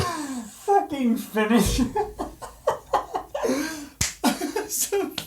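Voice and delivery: slow high cracking-up laugh. Real, not acted. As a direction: dissolve into laughter.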